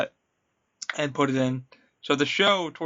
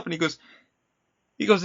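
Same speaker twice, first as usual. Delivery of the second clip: usual speaking voice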